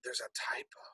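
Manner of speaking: breathy whisper under her breath; whispered aside. Real, not acted. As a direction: whisper it to yourself, just noticing. Muttering to herself about typo.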